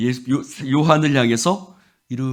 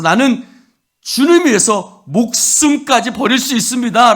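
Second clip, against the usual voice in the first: earnest vow; 9 semitones higher, 6 dB louder than usual. Acted. Vow voiced again.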